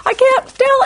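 high, loud squeaky complaint; hammed-up helplessness; mock panic. Teen who can't see the keys, squeaking in mock panic. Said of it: "I was like".

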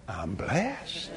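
low, soft and calm; quiet statement. Shown as a demonstration. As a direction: say it softly to yourself. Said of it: "I am grateful. Why?".

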